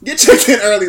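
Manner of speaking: eager clerk waving him in; cheerful welcome. Retold or imitated. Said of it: "I'd be like".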